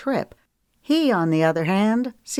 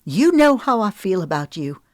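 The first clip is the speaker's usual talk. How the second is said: higher and louder, indignant building; spat out; 6 semitones higher, 5 dB louder than usual. Acted. Woman furious at being accused, higher and louder. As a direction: spit the words at him. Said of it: "she spat".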